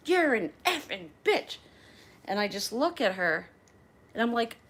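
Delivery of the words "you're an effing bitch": high-pitched imitation of a spiteful passenger; mock venom, pitched up; nasty insult. Passenger insults the flight attendant up close, nasty. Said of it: "she does this to me".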